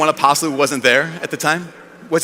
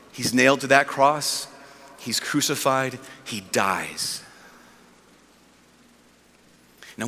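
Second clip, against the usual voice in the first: low, softer, grave telling; solemn sermon recounting; 7 semitones lower, 5 dB quieter than usual. Real, not acted. Preacher recounts the crucifixion to the congregation, low and solemn. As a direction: bring it down to a sombre hush.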